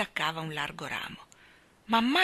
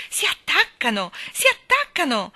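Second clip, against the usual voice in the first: electrified cry, climbing higher; thrilled shout; 7 semitones higher, 7 dB louder than usual. A narrator's reading. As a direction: shout it out loud, bursting with excitement. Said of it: "gridò Michael, addirittura elettrizzato". (grido elettrizzato)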